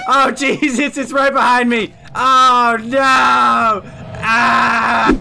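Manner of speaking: terrified screaming; screaming in real panic; scared horror game streamer. Real, not acted. Ghost right behind him.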